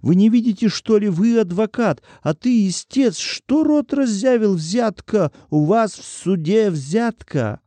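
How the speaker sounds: high-pitched outraged protest; indignant shouting. A narrator's reading. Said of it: "закричал Артём".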